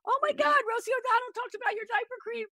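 high, fast, gushing news; excited callers. Retold or imitated. Friends leaving messages about Rosie's show, high fast excitement. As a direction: blurt it out breathlessly thrilled. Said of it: "each one was like".

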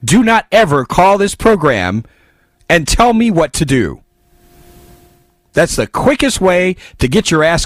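loud, high angry cutoff; angry shouting. Real, not acted. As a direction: shout the caller off the air. Host cutting off a caller.

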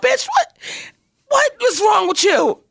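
high, exclaimed mock telling-off; hammed-up indignant challenge to your own reflection; scolding outburst. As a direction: snap at yourself in disbelief, played for comedy. Mirror reflection scolding her, retold.

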